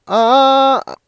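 sustained high ah, well above speaking voice; high comfortable note. Shown as a demonstration. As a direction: float an easy high ah. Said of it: "気持ちよく出せるこの高いキー".

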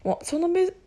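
usual speaking voice

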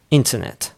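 "Internet" with a clearly released T; British-style "Internet", T not dropped; British crisp T. Shown as a demonstration. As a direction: keep every T in "Internet" firmly sounded. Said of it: "しっかり発音するんですよ".

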